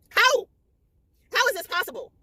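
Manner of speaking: a loud, very high "How?"; can't believe the twist; real shock. Real, not acted. Reacting to the paternity twist.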